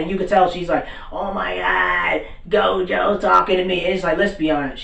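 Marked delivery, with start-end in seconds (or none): 1.1-3.8 imitating a drawn-out fangirl swoon; starstruck fangirl. Assistant gets talked to by Gojo, starstruck. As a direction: stretch it out like a smitten fan.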